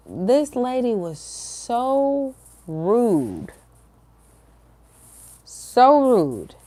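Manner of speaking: SO and RUDE drawn out slowly, pitched high; emphatic venting. Real, not acted. Telling viewers about the rude lady, emphatic on "so rude". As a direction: lean long and hard on "so rude".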